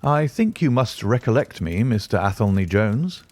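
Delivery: ordinary delivery, nothing marked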